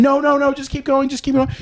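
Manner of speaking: very high, squeaky-pitched pleading; imitated urging wife.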